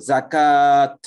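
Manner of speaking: জাকাত said loud, long alif on the middle; recited with alif. Shown as a demonstration. Teacher demonstrates the alif sound in জাকাত. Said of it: "এটা তো আলিফ উচ্চারণ হচ্ছে".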